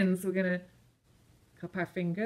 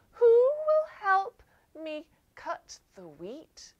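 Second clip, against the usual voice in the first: high, loud little hen; 11 semitones higher, 5 dB louder than usual. Acted. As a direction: ask brightly as the hen. Hen asks friends in story.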